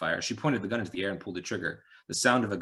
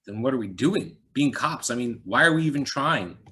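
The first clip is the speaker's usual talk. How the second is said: shocked; 5 semitones higher, 7 dB louder than usual. Acted. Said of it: "he said, shocked".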